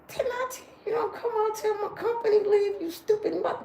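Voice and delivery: scolding the roaches. Pretend. She yells at roaches crashing her company, mock furious. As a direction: chase them off like naughty kids.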